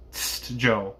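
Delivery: a warning hiss for attention. A narrator's reading. Coachman to the guard below, warning. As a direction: alert him with a "tst". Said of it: "cried the coachman in a warning voice".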